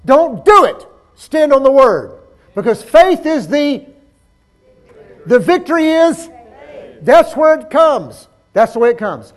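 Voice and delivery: high, loud, insistent sermon; full-force preaching. Real, not acted. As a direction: exhort the congregation with full voice.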